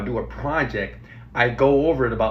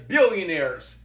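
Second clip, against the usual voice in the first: ordinary talk, nothing marked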